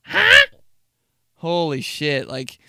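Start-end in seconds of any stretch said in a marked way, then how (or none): 0.1-0.5 high loud huh; shrill grandma huh; deaf grandma. Retold or imitated. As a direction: bark it like a half-deaf grandma. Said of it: "all she said ever was".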